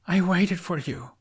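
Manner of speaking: plain reading voice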